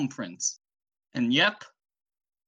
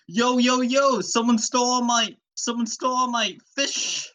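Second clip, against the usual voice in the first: high, loud, hammed-up complaint; high-pitched excitable victim; Fisherman Joe; 10 semitones higher, 4 dB louder than usual. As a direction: report a theft in a high, frantic cartoon voice. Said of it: "He's like".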